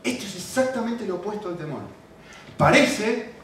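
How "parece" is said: parece punched higher and louder; stress on parece. Shown as a demonstration. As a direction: hit the word parece hard. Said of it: "el énfasis está en parece".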